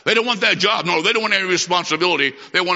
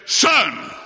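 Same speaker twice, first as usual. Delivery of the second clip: mother catching son; 3 semitones higher than usual. Retold or imitated. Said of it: "I heard a voice. The voice said".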